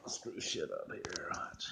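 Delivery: slow hushed whisper under the breath; quick whispered aside. Real, not acted. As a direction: murmur it so the stream barely hears. Aside on a live stream.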